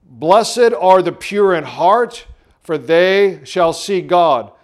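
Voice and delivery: slow, loud, solemn reading; scripture reading. Shown as a demonstration. Pastor reading the verse to the church, slow and loud. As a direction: read the verse slowly, weighing each word. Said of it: "let me read it to you right now".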